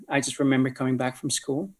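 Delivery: usual speaking voice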